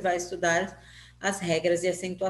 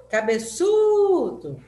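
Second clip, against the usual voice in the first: ÇU in "Cabeçudo" long, high and loud; "Cabeçudo" hollered with a stretched ÇU; calling out, ÇU prolonged; 7 semitones higher, 7 dB louder, much slower than usual. Shown as a demonstration. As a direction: call "Cabeçudo" like a name, holding the third syllable. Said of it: "Quem que eu falei mais?".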